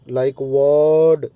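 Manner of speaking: वर्ड with the er stretched very long; long er sound. Shown as a demonstration. Teacher showing students the long er vowel in वर्ड. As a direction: hold the er in वर्ड slowly. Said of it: "यानी लंबी sound दे रहे हैं".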